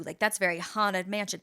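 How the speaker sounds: ordinary talk, nothing marked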